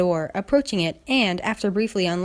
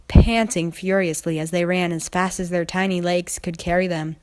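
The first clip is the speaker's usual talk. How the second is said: ordinary delivery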